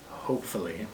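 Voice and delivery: quiet, unconvinced hopefully; doubtful. Real, not acted. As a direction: say hopefully as a doubtful aside, falling. Doubtful afterthought to earlier hope.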